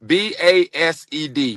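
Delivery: slow, separate letters for B A S E D; spelled out. Shown as a demonstration. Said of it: "this word is called based".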